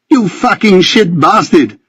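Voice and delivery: high, raging outburst; furious curse. A narrator's reading. Benjamin cursing Ricky in a rage. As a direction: spit it out in fury.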